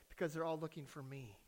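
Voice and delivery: very soft, low confession; quiet little boy's punchline; small child's voice. Acted. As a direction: confide it in a tiny hushed voice. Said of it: "silent voice said".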